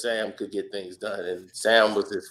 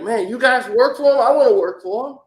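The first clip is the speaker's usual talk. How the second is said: eager fan; 11 semitones higher, 8 dB louder than usual. Retold or imitated.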